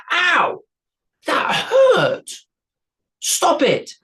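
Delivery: very high, loud yelps; troll in pain. A narrator's reading. Troll stabbed in the finger, high squealing ow. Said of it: "stabbed him in the finger".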